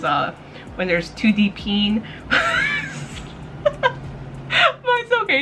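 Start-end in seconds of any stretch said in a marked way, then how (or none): 2.1-4.4 high breathless squeal of laughter; helpless airy shriek of laughter. Real, not acted. Laughs at censored scene. 4.5-5.4 said through real laughter, pitched high; giggly high reassurance; laughing. Real, not acted. Laughing at censorship bars.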